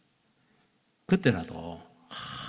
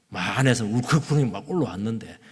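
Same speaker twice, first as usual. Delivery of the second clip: ordinary delivery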